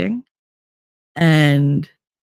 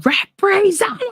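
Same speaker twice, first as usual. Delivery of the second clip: yelling into phone. Retold or imitated. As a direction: holler it at an automated phone line. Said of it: "I'm yelling into my phone".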